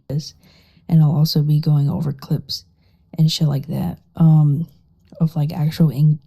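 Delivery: normal talking voice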